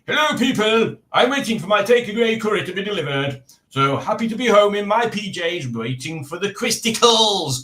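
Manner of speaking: high, loud put-on greeting voice; voicing Alex's assets. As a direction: pipe up high as a comic little character. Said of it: "that's what Alex's assets are saying".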